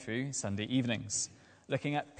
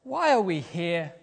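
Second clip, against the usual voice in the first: put-on ungrateful child complaining; 4 semitones higher, 5 dB louder than usual. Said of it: "I said".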